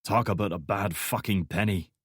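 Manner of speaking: lightly voiced menacing Scottish soldier; a hint of a threat, low. A narrator's reading. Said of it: "his voice low and menacing".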